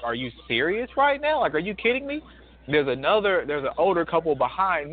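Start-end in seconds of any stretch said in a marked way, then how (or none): 0.0-2.2 higher, mock disbelief; a light imitation of stunned disbelief; incredulous bystander. The narrator and a stranger watching a customer's scene, in disbelief. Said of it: "We both are looking like".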